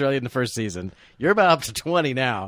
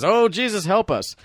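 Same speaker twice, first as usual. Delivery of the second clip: high, hammed-up cry for help; mock desperate prayer; 9 semitones higher, slower than usual. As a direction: beg heaven in a mock panic. Mock public pleading for rescue.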